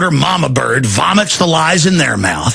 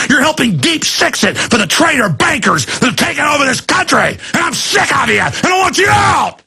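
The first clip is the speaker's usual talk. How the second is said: angry rant; 3 semitones higher than usual. Real, not acted. Ranting at the TSA agents, climbing to a furious shout.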